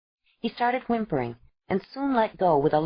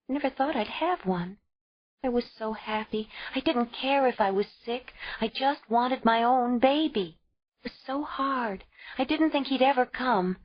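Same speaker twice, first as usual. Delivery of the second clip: put-on higher young woman's voice, pleading; desperate young mother; 7 semitones higher than usual. A narrator's reading. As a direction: beg to keep the baby, close to tears. Ayla pleading to keep baby.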